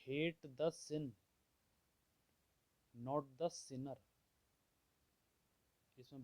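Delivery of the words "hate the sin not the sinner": very slow, weighty quote of a famous saying; slow, grave recitation of a proverb; slow solemn quote. Retold or imitated. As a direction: lay each word down slowly like a maxim. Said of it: "Mahatma Gandhi जी क्या बोलते थे".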